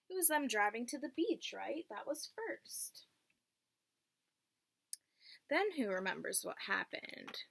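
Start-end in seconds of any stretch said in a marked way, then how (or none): none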